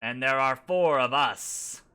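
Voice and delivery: slow, loud goblin bargaining; goblin haggling. Acted. Goblin chief haggling over the short payment, slow and loud. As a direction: press the point like a greedy goblin. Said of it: "whispers back and forth".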